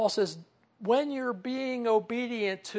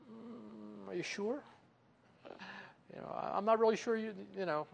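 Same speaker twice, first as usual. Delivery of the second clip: hesitant doubt; 5 semitones higher, 3 dB quieter than usual. Pretend. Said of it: "and we're hesitating".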